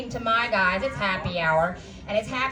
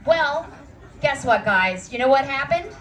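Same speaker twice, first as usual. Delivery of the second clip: ordinary delivery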